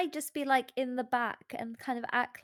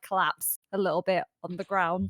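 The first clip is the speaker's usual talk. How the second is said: ordinary delivery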